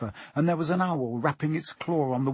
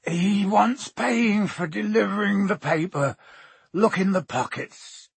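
slow, higher drowsy giant; Hagrid, half asleep; 7 semitones higher, 4 dB louder than usual. Acted. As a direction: drag it out from under sleep.

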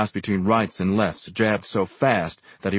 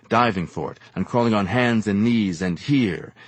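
ordinary delivery, nothing marked

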